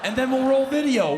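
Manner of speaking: very high, loud, laughing sales rush; manic pitch. Pretend. Comic pitching the burqa game to his wife, manic. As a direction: sell it like a crazed infomercial.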